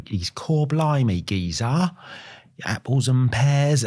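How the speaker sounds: slow, hammed-up Cockney; bad fake English accent. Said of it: "tries to do an English accent".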